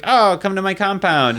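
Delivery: high, welcoming mock dictator; Mussolini. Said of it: "What a great Mussolini impression".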